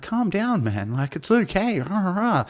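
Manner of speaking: high-pitched imitation of a crowd; imitating pleading crowd. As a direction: mimic onlookers urging calm, pitched well up. Retold crowd soothing comic.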